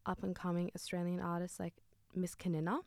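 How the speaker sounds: ordinary talk, nothing marked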